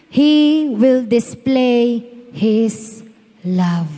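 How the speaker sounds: solemn preacher proclamation; slow sermon proclamation. Acted. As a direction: unveil the point one word at a time. Slow dramatic announcement of point six.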